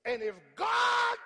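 high, slow, full-throated preacher's cry; preaching shout. Real, not acted. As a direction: lift the congregation with it.

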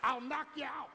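high, loud whooping preacher; preaching at full force, loud and high. Real, not acted. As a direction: shout it to the congregation.

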